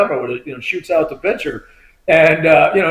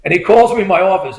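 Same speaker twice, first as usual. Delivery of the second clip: ordinary talk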